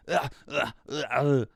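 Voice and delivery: short loud "ah" shouts; mock action-hero yells. Shown as a demonstration. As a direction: bark out repeated hammed-up cries. Shouted noises testing the mic.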